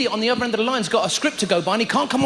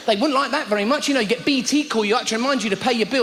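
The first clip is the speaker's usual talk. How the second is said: normal talking voice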